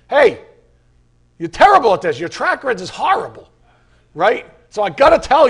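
hammed-up harsh reminder; mock scolding. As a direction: bark the reminder like a harsh critic.

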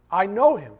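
loud stress on know; preacher insisting he knows him; emphatic. Real, not acted. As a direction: drive the word know home. Preacher repeats key phrase.

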